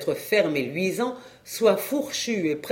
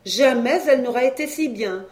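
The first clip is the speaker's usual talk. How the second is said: lightly voiced wife, higher pitch; eager wife; 6 semitones higher, 4 dB louder than usual. A narrator's reading. Wife promising the cow good care, eager.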